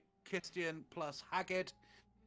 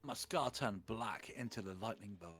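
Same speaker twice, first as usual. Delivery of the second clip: ordinary talk, nothing marked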